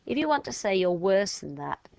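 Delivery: ordinary talk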